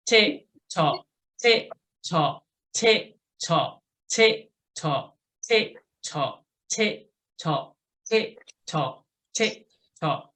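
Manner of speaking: metronome tick-tock with even gaps; steady ninety count. Shown as a demonstration. Coach marks each knee at the top, steady ninety count. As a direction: call an unhurried, regular pedalling beat. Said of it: "going for ninety for a minute".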